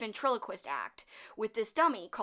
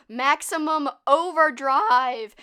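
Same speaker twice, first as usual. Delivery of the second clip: loud, slow trailer-voice title; movie-trailer announce; 3 semitones higher, 10 dB louder, much slower than usual. Acted. Host announcing next corny movie, loud trailer voice. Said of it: "announcing next movie".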